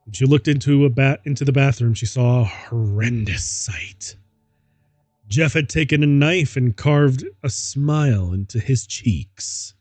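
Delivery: ordinary talk, nothing marked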